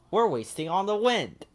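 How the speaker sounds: high, bright puppet-like Ernie; eager Ernie calling his friend. Acted. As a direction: lift into a cheerful high Ernie. Ernie's voice in story.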